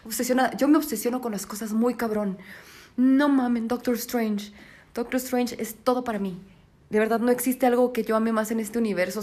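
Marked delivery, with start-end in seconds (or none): none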